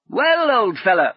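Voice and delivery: high, loud cheery call. Acted. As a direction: shout up the ladder. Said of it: "he called up to Paul".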